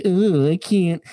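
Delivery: high mock-whiny cartoon complainer; hammed-up high cartoon voice. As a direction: whine it like a put-on cartoon crybaby. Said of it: "What is this voice?".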